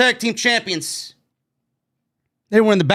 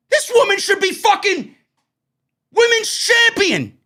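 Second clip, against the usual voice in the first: high-pitched, exasperated shouting; frustrated yelling rant; 8 semitones higher than usual. Real, not acted. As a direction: yell it in real, incredulous frustration. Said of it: "Why does J D yell?".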